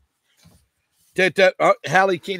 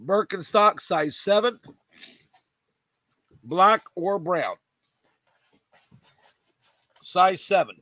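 ordinary delivery

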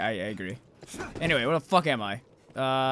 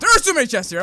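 higher, louder burst of suspicion; loud, suspicious repeat; 6 semitones higher, 8 dB louder than usual. Real, not acted. Player distrusts a room of chests, loud alarm. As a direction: raise the voice in alarm.

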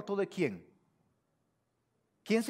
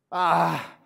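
loud, drawn-out "ah"; demonstrative sigh; 8 dB louder than usual. Shown as a demonstration. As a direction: heave a big, exaggerated "ah". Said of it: "ese A con H, hazle, ah".

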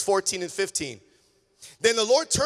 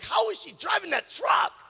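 high-pitched incredulous exclamation; amazed disbelief; 12 semitones higher, 4 dB louder than usual. Retold or imitated. Preacher marvelling at tiny woman driving truck, amazed. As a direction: retell it in loud, high astonishment.

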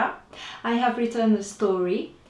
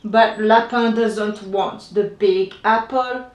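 plain reading voice

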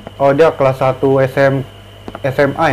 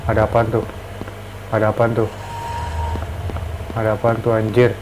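scared horror game streamer; 5 semitones lower, 8 dB quieter than usual. Real, not acted. Hears eerie sound, nervous.

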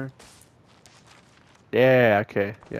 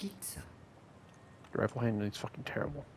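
muttered aside; 17 dB quieter, slower than usual. Real, not acted. Muttered complaint.

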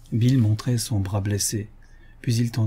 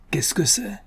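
much higher, sharp outcry; angry exclamation; 9 semitones higher than usual. A narrator's reading. General flaring at Bill's insolence, angry outburst. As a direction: burst out, stamping the ground. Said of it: "s'écria-t-il en frappant le sol".

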